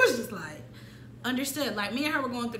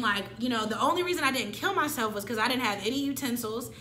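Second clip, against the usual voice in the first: ordinary talk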